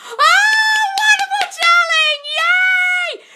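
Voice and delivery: very high, loud, over-the-top gushing; hammed-up luvvie squealing praise at a fan; mock gushing diva.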